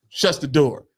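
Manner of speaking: ordinary talk, nothing marked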